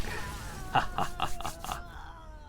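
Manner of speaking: high soft giggle; amused breathy laugh. Real, not acted. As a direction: let a light high giggle slip out. Laughs after winning.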